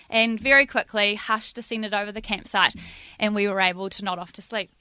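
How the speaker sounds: usual speaking voice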